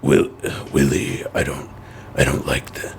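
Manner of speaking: low gruff Scandinavian man; deep, gruff and halting; Mads Mikkelsen impression. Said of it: "can you do a Mads Mikkelsen impression".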